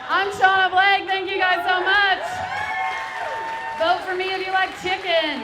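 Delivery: very high, loud laughing sign-off; big sign-off shout. Real, not acted. To the cheering crowd, a high shouted sign-off. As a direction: shout your name and leave them cheering.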